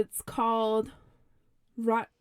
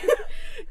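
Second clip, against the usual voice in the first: high burst of laughter; 5 dB louder than usual. Real, not acted.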